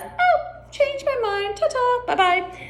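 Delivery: very high, squeaky Duchess voice; flustered Duchess. Acted. Duchess backs out on seeing the Queen, very high. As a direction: squeak a hurried goodbye, very high. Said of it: "And the Duchess says".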